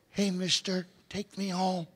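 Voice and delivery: soft, quiet puppy voice; little puppy. Acted. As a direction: plead softly as a tiny puppy.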